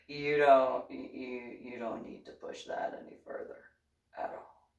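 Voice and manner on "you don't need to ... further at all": soft whispered warning; hushed warning. Retold or imitated. Telling Travis not to push further, in a hush. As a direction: tell him to drop it under your breath.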